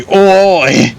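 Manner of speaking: loud high Cockney shout of "Oi!". Retold or imitated. Streamer mocks a beret-wearing Cockney guy, loud high Cockney "Oi!". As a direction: bark "Oi!" like a Cockney thief. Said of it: "Imagine a random guy with the beret and say".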